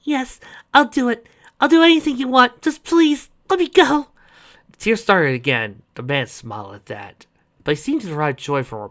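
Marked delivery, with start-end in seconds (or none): 0.0-4.1 high, desperate begging; pleading girl voice. Acted.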